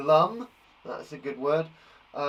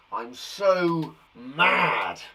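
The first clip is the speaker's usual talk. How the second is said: very drawn-out, louder pretend anger; mock mad; 5 dB louder, much slower than usual. Shown as a demonstration. Teacher acting out synonyms for angry, mock mad. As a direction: stretch it out, hammed-up fuming.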